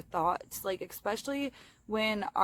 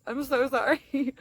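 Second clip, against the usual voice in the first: giggly embarrassed apology, higher and louder; laughing apology; 6 semitones higher, 8 dB louder than usual. Real, not acted.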